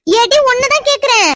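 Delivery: high, slow, drawn-out scold; exasperated scolding. Acted. Mother scolding her daughter, exasperated and sharp. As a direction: drag it out, fed up with her.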